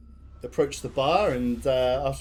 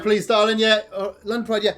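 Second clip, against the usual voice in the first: high, loud busy barmaid; brisk and hearty landlady; 6 semitones higher, 5 dB louder than usual. Acted. Busy barmaid answering the player, high and loud. Barmaid serving another customer.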